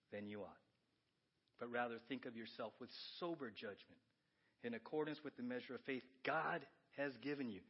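low, soft, even reading; scripture reading. Acted. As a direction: read the verse plainly and gravely. Reading Romans aloud.

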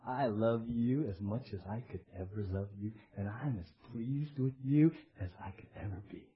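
low, soft declaration of love; God's hushed, loving voice; tender divine reassurance. Acted. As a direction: speak it gently and quietly, deep down. Voicing God's love, after outburst.

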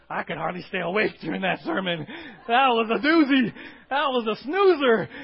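loud laughing gripe about a dull sermon; mock complaint, laughing. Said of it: "left church saying".

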